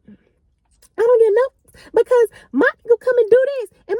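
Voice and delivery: high loud mock rant; going ham. Drunk friend threatening, high loud mock rant. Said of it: "she was like".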